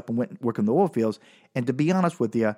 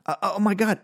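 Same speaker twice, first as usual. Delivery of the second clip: high, stunned what-the-fuck; squeaky stunned disbelief; shocked disbelief; 8 semitones higher than usual. Retold or imitated. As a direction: reel back in shock. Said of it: "And so I'm just like".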